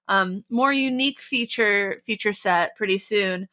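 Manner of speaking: usual speaking voice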